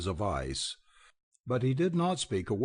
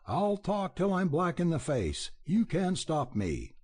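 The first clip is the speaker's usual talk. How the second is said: a raised, snarling burst of defiance; snarling outburst; 7 semitones higher than usual. A narrator's reading. Radford Chase defying Belding, in a livid snarl. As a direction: spit the threat out, higher than usual. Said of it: "livid and snarling, burst out".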